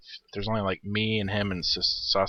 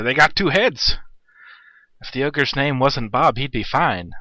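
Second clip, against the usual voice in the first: lightly voiced imitation of his friend Orange; mock his buddy's chat voice, a bit louder; slight put-on voice of a gamer friend; Orange impression; 4 semitones higher, 5 dB louder, slower than usual. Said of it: "that's my orange impression".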